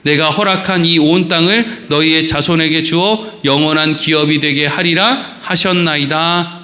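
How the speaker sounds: formal pulpit scripture reading. Acted. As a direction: read the passage with solemn weight. Reads scripture aloud.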